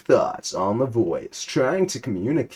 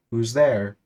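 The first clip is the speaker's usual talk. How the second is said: normal talking voice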